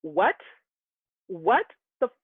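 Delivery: retold angry outburst, rising in pitch; retold yell. Sibling confronts man who insulted twin brother, retold angry yell.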